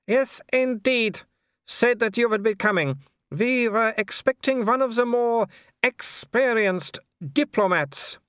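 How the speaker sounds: high, slow, condescending aristocrat; haughty baroness. A narrator's reading. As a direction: drawl the insult politely. Seraphine voiced high.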